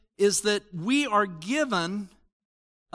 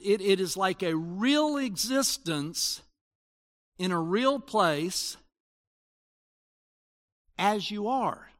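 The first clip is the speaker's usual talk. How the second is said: ordinary talk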